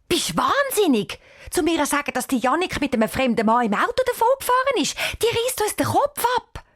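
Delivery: high, fast, agitated refusal; horrified at the very idea. Acted. Cheyenne refuses to wake the aunt, agitated and high. Girl rejects waking angry aunt.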